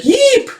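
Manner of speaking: very high squeaky yelp; sudden tiny shriek; startled yelp. Acted. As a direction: yelp as if zapped. Erica startled by a spark.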